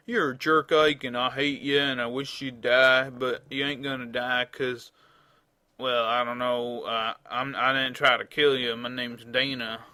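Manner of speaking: loud mock-Southern insults; hammed-up Southern accent, man playing a woman. Player voices Dana berating Ike, southern accent. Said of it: "Dana's got a southern accent now and is a man".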